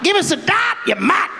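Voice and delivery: high, aggressive bogan fish voice; high-pitched mock-aggressive bogan yelling; aggro meth-head fish. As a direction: snarl it like a cranky junkie. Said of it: "Just comes past like".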